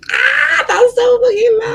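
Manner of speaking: reacting to the psychic's uncanny message with a loud cry; shocked outcry. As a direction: yelp it out, overwhelmed by the coincidence.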